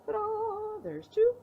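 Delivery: high bright reveal of the socks; showy ta-da. Real, not acted. Showing the knitted socks to viewers, high ta-da. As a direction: sing out the reveal like a magic trick.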